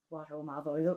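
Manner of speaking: put-on "What ho, Malvolio?" said in her own Northern Irish accent, a little deflated. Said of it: "didn't sound right in my accent".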